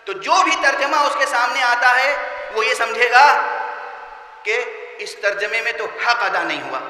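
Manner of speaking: ordinary talk, nothing marked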